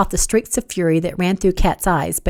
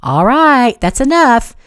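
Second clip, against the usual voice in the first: raised, higher voice calling for order; controlled mediator; 5 semitones higher, 6 dB louder, slower than usual. A narrator's reading. Charles breaking up a family squabble, controlled but raised. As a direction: cut in firmly, louder and higher. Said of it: "the controlled voice of a mediator".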